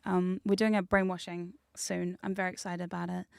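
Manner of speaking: usual speaking voice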